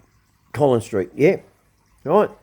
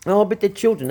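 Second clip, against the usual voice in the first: higher, scornful imitation of a bleeding heart; mock whiny objection; 7 semitones higher than usual. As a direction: sneer it as the other side would. Mocking objection from soft people.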